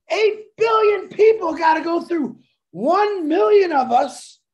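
loud, high, fired-up call; rally the crowd with it; excited shout. Real, not acted. Host shouts the big number to fire up the founders. Hyping eight billion customers.

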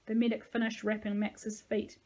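usual speaking voice

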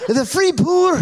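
high insistent Scottish woman; Scottish lilt, very high, stubborn; imitating Ruth's Scottish insisting; Scottish accent. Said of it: "my great Ruth".

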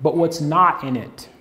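slow, deliberate, word by word; unhurried preacher's emphasis; slowly. Shown as a demonstration. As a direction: lay it down slowly so they can chew on it. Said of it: "let me say this slowly".

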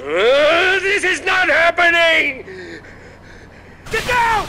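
high, loud panic; panicked shout. Acted. Lynch screaming in panic as the attack starts. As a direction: yell in disbelief and warn.

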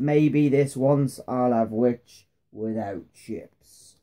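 low, very slow dragon voice; hungry dragon. A narrator's reading. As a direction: savour each word like a hungry dragon. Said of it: "The dragon drew ne".

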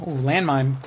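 normal talking voice

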